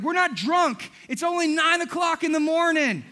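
high, loud insistence; loud preaching. Acted. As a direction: shout it to the crowd. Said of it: "He's like".

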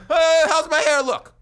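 loud drawn-out mock primping; groggy vain self. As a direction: ham up the sleepless guy fussing over his hair. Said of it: "and be like".

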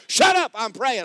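sudden high, loud outburst; exasperated shout. Acted. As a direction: explode at the pestering voice. Finally shouting down the nagging voice.

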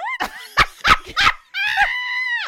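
loud high shriek of laughter; shocked shrieking laugh. Real, not acted. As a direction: burst out in a screaming laugh. Shocked by coworker's weave question.

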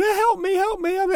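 high, whiny child's plea, imitated; hammed-up little girl begging for help; imitating crying child. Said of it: "crying and screaming".

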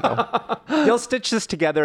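laughing while talking. Real, not acted. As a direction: talk through a laugh.

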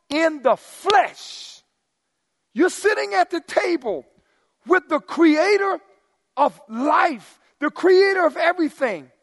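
high, loud, slow fervent preaching; preacher thundering about sitting with God; preaching at full force. Real, not acted. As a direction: build the awe, proclaim it.

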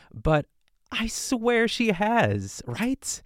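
very high, drawn-out protest; disbelieving insistence. Real, not acted. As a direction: stretch it out high, incredulous. Doubts the never-had-martini claim.

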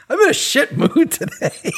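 real laugh while owning up to a bad mood. Real, not acted. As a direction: laugh through a self-mocking admission. Laughs at own rant.

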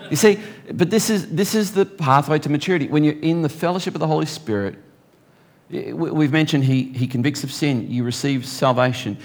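ordinary talk

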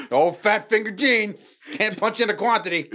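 high, loud furious boss yell; angry boss. Pretend. Pretend manager yells at workers, angry. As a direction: yell at the staff over a botched order.

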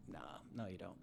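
low, soft dismissive brush-off. Retold or imitated. Coach calling out a client, low and soft. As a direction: quietly shut the claim down.

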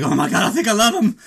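put-on high, loud, fast swoon of a smitten girl. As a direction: gush it out like a starstruck girl. Little Marie swooning over Rocky.

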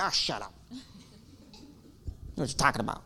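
quiet, dismissive, slow mutter; scornful grumble. Retold or imitated. Bitter believer dismissing other Christians, scornful mutter. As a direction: brush them off with contempt. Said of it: "I hear Christians talk like".

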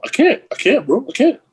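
high, squeaky protest; exasperated refusal. Real, not acted. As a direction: push it away, half laughing.

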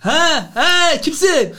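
high, loud who's-calling; gruff phone answer. Acted. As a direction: bark who is this down the line. Mümtaz picks up the call.

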